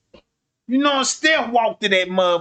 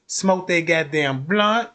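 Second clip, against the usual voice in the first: ordinary talk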